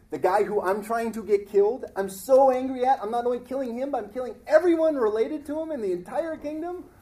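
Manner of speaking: loud, indignant rant, rising high; mock fury building up; Haman's outrage. Preacher voicing Haman ranting about the king's order, loud and indignant. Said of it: "Haman goes".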